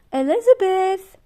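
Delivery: high, loud calling. A narrator's reading. Mother calling daughter from afar, high and loud. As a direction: call her from another room. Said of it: "called Mommy".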